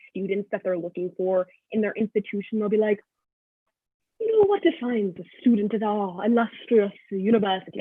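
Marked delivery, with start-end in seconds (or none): 4.2-7.8 mock British college spokesperson; British accent. College describing its ideal student, British accent. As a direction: put on a grand British accent. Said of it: "why there's a British accent".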